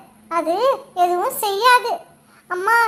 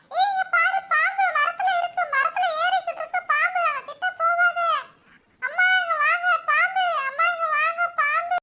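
higher, softer scared cry of snake; a kid spotting a snake, alarmed and hushed; frightened child; 7 semitones higher, 6 dB quieter than usual. Acted. (பாம்பைப் பார்த்து பயந்த குழந்தையின் குரல்)

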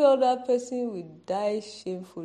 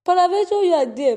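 prophet voice; 8 semitones higher, 8 dB louder, faster than usual. Acted. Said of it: "I'm talking like a prophet now".

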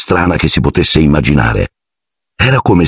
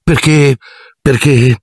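higher, halting repeats; nervous higher stammer; hesitant stammer; 10 semitones higher, slower than usual. Acted. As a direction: stall for an answer. Harry hesitating, heart pounding.

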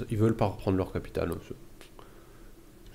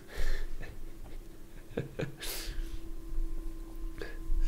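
whispered laugh; 4 dB quieter than usual. Real, not acted. Laughs at meat joke.

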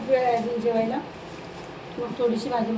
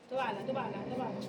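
hushed; 13 dB quieter than usual. Real, not acted. Hushed alarm.

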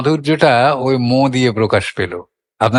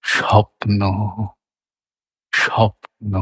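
soft স্বপ্ন, second syllable drawn out and lowered; gliding demo; 3 dB quieter, slower than usual. Shown as a demonstration. Teacher demonstrating how the note falls on স্বপ্ন, gliding demo. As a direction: say স্বপ্ন in two parts, letting ন settle down gently. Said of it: "নোতে স্বরটা একটু গড়িয়ে মিড দিয়ে নেমেছে".

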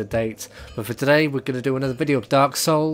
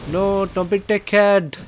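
frustrated plea; 8 semitones higher, 8 dB louder, slower than usual. Real, not acted. Pleading with the game enemy.

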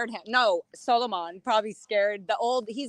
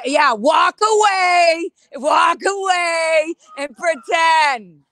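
angry shout; 3 semitones higher, 4 dB louder than usual. Real, not acted. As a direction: drag out walk away as a jeer. Berating guard who walks away.

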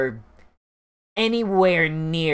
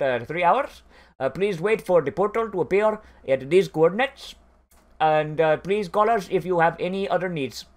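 mock Indian-accented call-centre clerk, pitched up; 3 semitones higher than usual. Bored NPC secretary taking a golem order by phone, hammed-up Indian accent. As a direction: put on a routine customer-service voice with an Indian accent. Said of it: "really bored N P C with an Indian accent".

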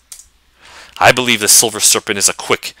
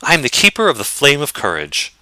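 higher, courtly lady, glad and gracious; noble lady voice; 5 semitones higher than usual. Acted. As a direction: play the lady of the keep, warmly welcoming.

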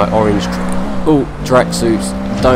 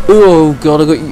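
usual speaking voice

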